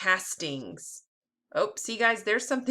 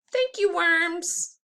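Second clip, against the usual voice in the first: grateful thanks; 10 semitones higher, 4 dB louder, much slower than usual. Acted. Closing line of the book.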